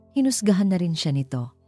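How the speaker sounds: ordinary delivery, nothing marked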